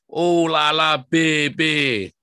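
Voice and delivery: loud, high "oh lala" flourish; hammy catchphrase. Acted. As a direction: ham it up like a showman. Show catchphrase, hammed.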